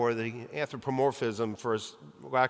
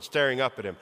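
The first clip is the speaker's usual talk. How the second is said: ordinary talk, nothing marked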